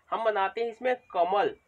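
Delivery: usual speaking voice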